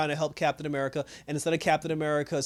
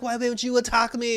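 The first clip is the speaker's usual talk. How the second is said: higher-pitched indignant quote; mock angry; 7 semitones higher than usual. Angry girl challenges opponent who won't fight, mock indignant. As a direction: imitate her mad challenge, pitched up. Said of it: "then she got mad".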